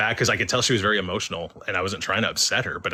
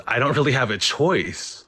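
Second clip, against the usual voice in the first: mildly upset, higher and louder; retold tearful protest; 5 semitones higher, 5 dB louder than usual. Ghost explains she is trapped here, retold mildly tearful. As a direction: imitate someone getting emotional, without real tears. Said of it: "she gets emotional. She starts crying".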